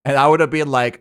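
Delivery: ordinary talk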